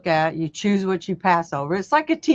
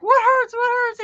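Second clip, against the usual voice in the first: high, urgent mock concern; worried wife; 14 semitones higher, 4 dB louder than usual. Wife rushing in to her husband, mock worried. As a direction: rush the question like a fussing wife. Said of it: "is like".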